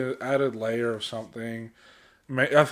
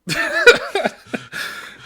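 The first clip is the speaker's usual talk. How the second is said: high, loud burst of breathy laughter; real laugh; 12 dB louder than usual. Real, not acted. Co-host cracks up at his own teasing jab, laughing. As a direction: crack up at your own joke.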